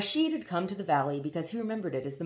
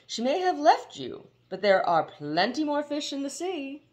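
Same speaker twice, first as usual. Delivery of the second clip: gushing slick gent; 10 semitones higher, 5 dB louder than usual. Acted.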